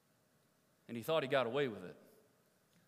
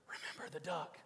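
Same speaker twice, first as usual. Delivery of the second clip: hushed, menacing whisper; whispered threat; 6 dB quieter than usual. Acted. Sister whispering a threat to her little brother. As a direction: breathe it as a secret threat.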